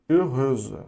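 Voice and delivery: heureuse slow, voiced z at the end; French feminine form. Shown as a demonstration. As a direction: heureuse drawn out as a French model word. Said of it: "e o de baixo, heureuse, é feminina".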